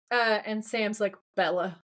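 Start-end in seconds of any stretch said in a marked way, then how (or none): 1.4-1.8 low, understated loathing; contemptuous. Retold or imitated. Sam greeting Bella as if wishing her dead, mock contempt. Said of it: "He says it in the tone of, "I hope you drop dead".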